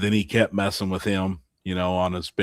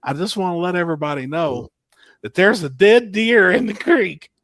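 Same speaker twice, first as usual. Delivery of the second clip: high, loud PA announcement; mock announcer; 9 semitones higher, 7 dB louder than usual. Imitating Jamie warning the crowd over the mic, loud and high. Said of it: "Picks the mic up immediately and was like".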